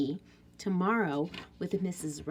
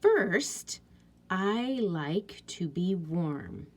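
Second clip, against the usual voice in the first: slow read-aloud; much slower than usual. Shown as a demonstration. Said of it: "reading final copy slowly".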